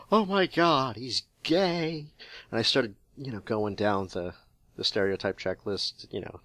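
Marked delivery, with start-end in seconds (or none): 0.1-2.1 higher, louder mock panic; mock alarm. Retelling his school shock to co-host, mock alarm. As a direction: blurt it like a startled teen.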